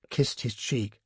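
plain reading voice

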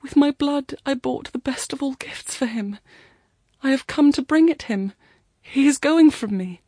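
high, loud, desperate protest; anguished cry. A narrator's reading. Girl watching him leave by boat, crying out. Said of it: "She cried".

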